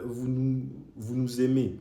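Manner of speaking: ordinary delivery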